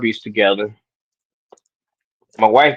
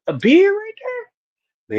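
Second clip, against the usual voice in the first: surprised. Retold or imitated. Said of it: "And I'm like".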